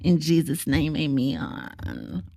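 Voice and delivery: high, syrupy sweet hospitality voice; sweet Southern host. Retold or imitated. Mocking Republicans as sweet church hosts, sugary high voice. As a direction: lay on the honeyed charm like a church lady. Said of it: "Republicans are like".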